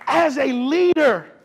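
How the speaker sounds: high, loud, emphatic sermon delivery; full-force preaching. Acted. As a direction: preach it hard to the room.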